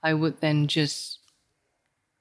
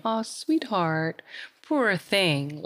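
California sweet talk; 2 semitones higher, much slower than usual. Retold or imitated. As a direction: mimic a gushing Californian comforter, stretched out. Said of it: "People talk like that in California".

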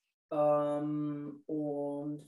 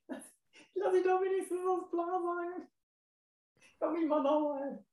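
laughing; 8 semitones higher, 10 dB louder than usual. Real, not acted. Laughing protest after early guess.

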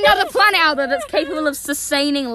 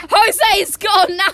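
excited shout; 5 semitones higher, 8 dB louder, much slower than usual. Real, not acted. Excited shout about the snail.

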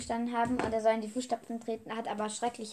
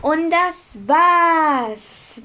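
drawn out; 6 semitones higher, 13 dB louder, much slower than usual. Real, not acted. Wrapping up the review.

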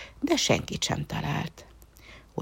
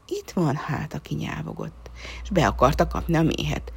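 ordinary delivery, nothing marked

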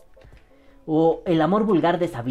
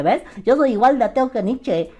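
put-on higher, smug know-it-all teenager; smug teen; 7 semitones higher, 3 dB louder than usual. Teen bragging to the streamer about atheism, smug higher voice. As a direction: brag like a sixteen-year-old who just found atheism. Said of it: "un niño de dieciséis años a decirte".

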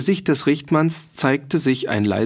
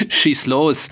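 light chuckle into the words; laughing; 4 semitones higher than usual. A narrator's reading. Mercenary telling the farmer to speak up, laughing. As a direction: invite him to speak with a laugh. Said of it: "Der Soldknecht lachte".

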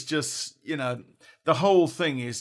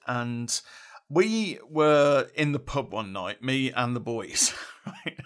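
ordinary talk

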